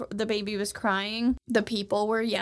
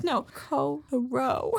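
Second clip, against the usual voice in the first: drawn-out scolding; slower than usual. Acted. Acted quote of scolding.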